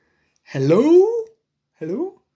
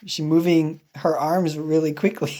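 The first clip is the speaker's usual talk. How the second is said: usual speaking voice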